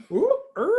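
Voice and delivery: high comic ooh of alarm; a sudden yelp at the news; mock dismay. Realizing baby coming, mock dismay.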